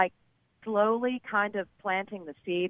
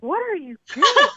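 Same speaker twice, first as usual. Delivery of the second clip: shocked; 11 semitones higher, 7 dB louder than usual. Retold or imitated. Shocked at guy's behavior.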